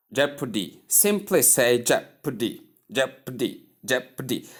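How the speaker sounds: JEopardy, stress on the first syllable, rest fading. Shown as a demonstration. Teacher drilling jeopardy with first syllable stress for students. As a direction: punch JE in jeopardy and let the rest drop. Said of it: "stress is given at the first syllable".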